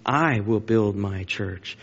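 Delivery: I stretched out, the rest slow and measured; stress on I. Shown as a demonstration. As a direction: hold the word "I", then continue slowly. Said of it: "I emphasized it on purpose".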